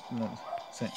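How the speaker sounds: ordinary talk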